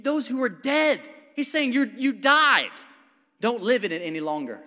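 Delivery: loud, rising preacher's call about Abraham's action; fiery preaching. Acted. Pastor urging the congregation, preached loud and high. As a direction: build the room up, pushing each line harder.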